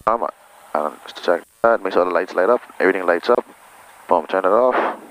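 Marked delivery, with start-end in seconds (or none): none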